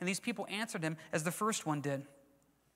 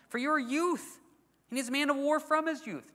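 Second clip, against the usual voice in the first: higher, cautioning voice of Saul; doubtful king; 7 semitones higher, 3 dB louder than usual. Acted. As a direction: warn the boy he's outmatched. Saul doubting young David.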